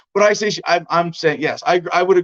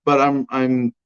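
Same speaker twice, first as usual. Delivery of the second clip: ordinary talk, nothing marked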